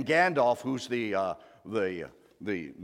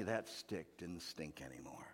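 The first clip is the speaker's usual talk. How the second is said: whispered with relief; 5 semitones lower, 14 dB quieter than usual. Acted. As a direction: hush it, almost no voice. Relief that stink is gone.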